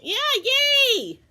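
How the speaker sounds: very high, loud cheer. Acted. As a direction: cheer him on, high and bright. Cheering continues.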